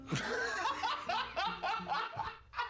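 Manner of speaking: high burst of laughter. Real, not acted. Laughter at the worms line.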